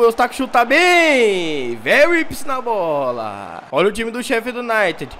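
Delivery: lively football commentator calling the action; play-by-play commentary. Acted. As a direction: call the match like a TV announcer. Live match commentary in game.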